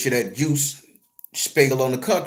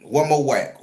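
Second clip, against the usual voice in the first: ordinary delivery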